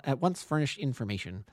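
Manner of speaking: usual speaking voice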